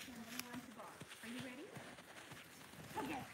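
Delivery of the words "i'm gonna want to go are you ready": normal talking voice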